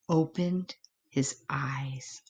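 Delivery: soft whispered narration; soft hushed telling. A narrator's reading. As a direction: breathe it out in suspense. Quiet suspense.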